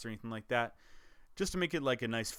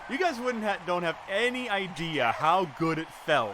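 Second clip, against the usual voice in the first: excited; 5 semitones higher, 6 dB louder than usual. Real, not acted. Reliving the thrill of winning.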